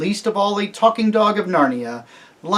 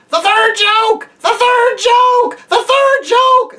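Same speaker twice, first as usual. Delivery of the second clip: very high, slow cawing jackdaw squawk; great excitement; 8 dB louder, slower than usual. A narrator's reading. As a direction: shriek it with glee like a bird. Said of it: "in great excitement".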